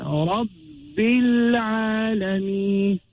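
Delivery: Rabbil Alameen slow, doubled b and long vowels; shadda on ba. Shown as a demonstration. Teacher showing the shadda, Rabbil Alameen slowly recited. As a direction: press the bb of Rabbil Alameen, then stretch. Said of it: "The ba holding a shadda".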